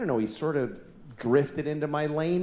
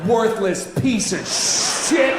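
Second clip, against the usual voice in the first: slow road-rage insult; 3 semitones higher, 4 dB louder, much slower than usual. Retold or imitated. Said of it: "this came out of my mouth".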